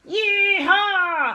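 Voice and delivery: high loud whooping howl. Acted. Monster yanking back the covers, howling. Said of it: "howled Mister Beast".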